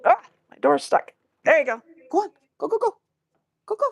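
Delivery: high, hurried coaxing; urging the dog. Real, not acted. Speaker hurrying her dog through a stuck door, high urging. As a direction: shoo the dog through, pitch up.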